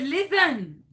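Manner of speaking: hammed-up French-Canadian lilt, swooping up high; fake Canadian accent. Caller blaming chemo for forgotten number, fake Canadian accent. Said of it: "What happened to your accent?".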